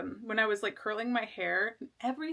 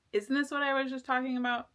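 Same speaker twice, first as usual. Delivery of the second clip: ordinary talk, nothing marked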